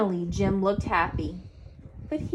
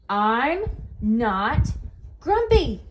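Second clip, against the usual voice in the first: angry shout; 2 semitones higher, 4 dB louder, slower than usual. A narrator's reading. Jim snaps at all the others, shouting. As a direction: build to an exploding "grumpy". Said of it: "shouted Jim as he beat his chest".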